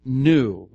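"New" said loud and alone; stress on NEW. Shown as a demonstration. Pastor points out the verse's emphasis, "New" stressed hard. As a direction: punch "New" as a single word. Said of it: "an emphasis".

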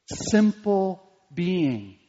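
Simple being drawn out very slowly; slow, weighty repetition; slow emphasis. Shown as a demonstration. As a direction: stretch each word of Simple being.

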